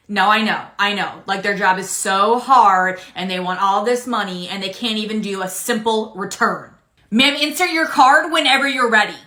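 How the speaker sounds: usual speaking voice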